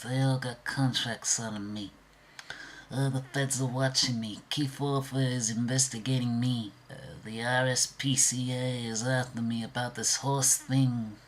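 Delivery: a hunted crook spilling his worries; paranoid crook impression. Shown as a demonstration. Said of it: "easy for me to do impressions".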